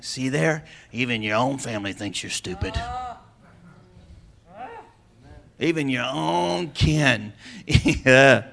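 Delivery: low taunting devil voice; devil's taunt. Retold or imitated. Preacher voicing the devil whispering lies on your shoulder, low taunt. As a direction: sneer it low and slow like the devil.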